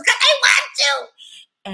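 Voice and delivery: very high, loud, drawn-out mock wail; mock wailing retort. Crying companion answers back, mock wailing.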